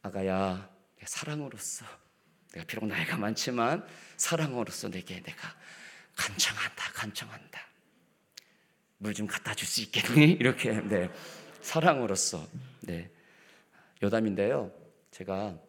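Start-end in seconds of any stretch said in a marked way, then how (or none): 0.0-10.3 soft, quiet, tender asking; loving request. Shown as a demonstration. Pastor showing how to ask lovingly, tender. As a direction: ask sweetly, like to a child. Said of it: "이게 아니고요, 사랑으로서".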